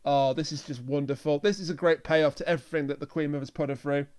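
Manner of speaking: ordinary talk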